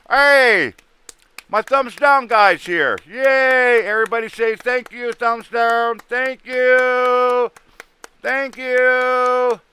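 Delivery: high, loud mocking cheer; sarcastic excited thanks. Real, not acted. Excited thanks to thumbs-down guys.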